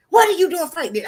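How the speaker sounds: uncle scolding. Retold or imitated. Uncle pulling her out of a fight, scolding. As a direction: snap at the kid like a startled uncle.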